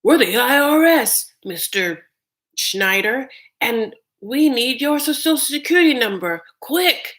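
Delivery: loud, high pushy scammer voice; mock IRS scammer demanding numbers; scam caller. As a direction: bark it like a fake official. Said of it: "just be like".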